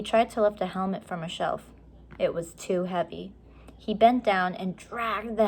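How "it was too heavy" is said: ordinary delivery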